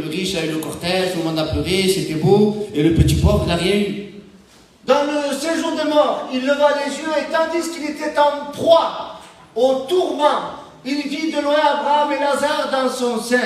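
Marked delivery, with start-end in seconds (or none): 4.9-13.4 raised, measured read-aloud gospel voice; solemn Bible reading from the pulpit; scripture reading. Acted. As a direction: lift the voice and read the verse like scripture. Reads the gospel passage aloud.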